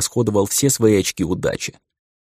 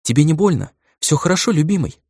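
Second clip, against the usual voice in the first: worried; 7 semitones higher than usual. Acted. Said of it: "обеспокоенно посмотрела она".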